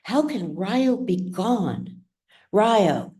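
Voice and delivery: ordinary delivery, nothing marked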